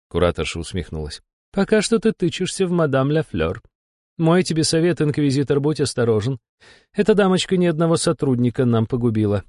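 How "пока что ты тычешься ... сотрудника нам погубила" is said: higher-pitched woman boss, teasing then cautioning; high female character voice with a smirk; wry demon curator. A narrator's reading. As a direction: needle him, then warn him, voice pitched up. Curator teases and warns the hero.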